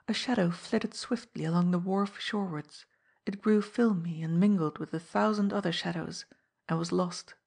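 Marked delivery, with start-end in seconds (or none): none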